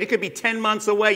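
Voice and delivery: ordinary talk, nothing marked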